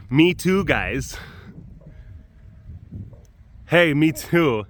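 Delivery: mildly silly little tiger voice; a half-hearted, slightly goofy call. Retold or imitated. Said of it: "it does sound a little silly".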